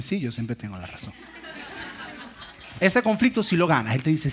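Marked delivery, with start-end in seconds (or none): 0.0-1.1 mock humble. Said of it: "muy humildemente te dicen".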